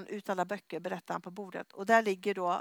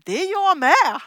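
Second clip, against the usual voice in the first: louder and higher punchline; delighted reply; 7 semitones higher, 13 dB louder than usual. Retold or imitated. Plumber reveals he is saved too, loud gleeful punchline. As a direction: land it with glee.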